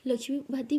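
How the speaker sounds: plain reading voice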